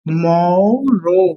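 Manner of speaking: Tomorrow stretched out very slowly, syllable by syllable; slow pronunciation demo. Shown as a demonstration. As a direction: drag tomorrow out slowly so the class can copy it. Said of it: "मैं धीरे आपको बोल के समझा रहा हूं".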